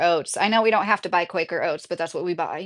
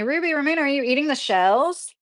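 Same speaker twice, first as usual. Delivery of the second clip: surprised; 6 semitones higher than usual. Real, not acted. Shocked question to viewer.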